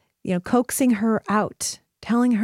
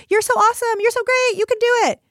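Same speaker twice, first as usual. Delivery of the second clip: much higher and louder, mock over-the-top cheering; gushing self-esteem praise; 13 semitones higher, 4 dB louder than usual. Self-esteem movement parent praising a kid, exaggerated mock gushing. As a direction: ham up a parent who praises everything. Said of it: "that we would just say".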